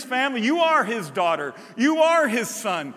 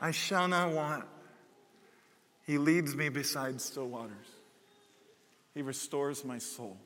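put-on soft little child reciting; a hint of a three-year-old; preschooler; 8 semitones lower, 10 dB quieter than usual. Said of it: "that's my impression of a three-year-old".

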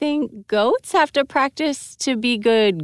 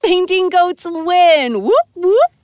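louder, cheering the result; 7 semitones higher, 5 dB louder, slower than usual. Acted. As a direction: whoop it up like a fan.

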